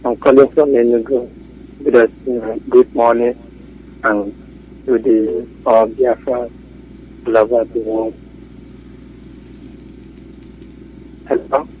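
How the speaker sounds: faint voice, far from the phone; weak caller on a bad line; barely audible. Real, not acted. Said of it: "your voice is very faint".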